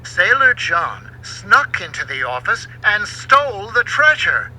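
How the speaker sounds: plain reading voice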